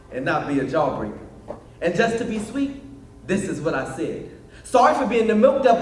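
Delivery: rhythmic, playful slam delivery; spoken word love poem. Acted. Poet pours out his heart to his sweetheart, rhythmic spoken word. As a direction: roll through it in rhythm, dropping at each punchline.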